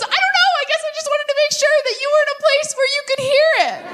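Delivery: very high, fast and sing-song; sweet gushing friend. Retold or imitated. Courtney explaining to the comic, very high and sweet. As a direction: babble it out sweetly.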